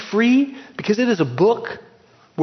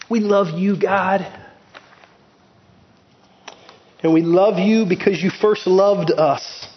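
prayer. Acted. Closing prayer over congregation.

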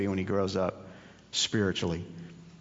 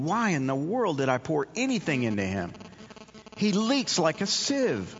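disappointed God; 7 semitones higher than usual. Retold or imitated. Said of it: "He's just shaking His head going".

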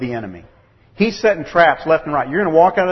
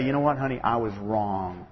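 humble apology; 4 semitones lower, 8 dB quieter than usual. Retold or imitated. Father apologizing to his daughter, humble and soft. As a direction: say it gently to your child. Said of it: "humble yourself and say".

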